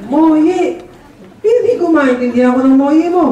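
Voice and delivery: high, puzzled questioning; a confused father who can't understand; mock bewilderment; baffled shopkeeper. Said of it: "Sabi ng tatay".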